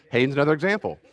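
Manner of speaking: ordinary delivery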